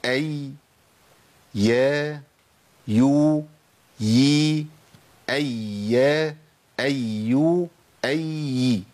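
teacher drilling أي يا يو يي in turn; ya vowel drill. Shown as a demonstration. Teacher leads students through the ya sounds, crisp drill. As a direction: sound out أي يا يو يي with clear leaning vowels. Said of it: "تاني وركزوا معايا".

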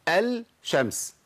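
الشمس slowly split as 'el... shams'; drawn-out 'el-shams' with audible L; unmerged lam. Shown as a demonstration. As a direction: sound a separate L, then shams, in الشمس. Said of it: "انا بشيل اللام واحط شين".

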